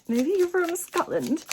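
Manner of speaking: usual speaking voice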